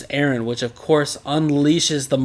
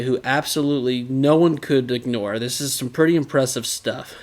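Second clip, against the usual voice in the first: ordinary delivery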